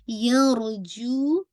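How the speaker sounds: यरजू drawn out slowly with heavy र; Quran recitation demo. Shown as a demonstration. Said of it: "रॉ को heavy कीजिएगा". (यरजू को धीरे, भारी र के साथ पढ़ना)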